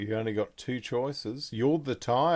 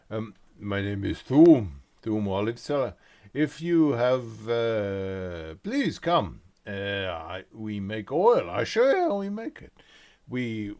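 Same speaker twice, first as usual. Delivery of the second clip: loud, proud merchant with broken grammar; olive seller; 7 dB louder than usual. Acted. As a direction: boast about the family olive trade. GM resumes olive seller NPC.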